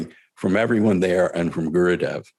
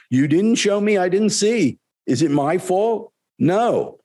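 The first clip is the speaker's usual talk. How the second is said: clearly higher, lively guru voice; voiced guru, higher and eager; Gurudev; 9 semitones higher than usual. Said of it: "quoting Gurudev's reply".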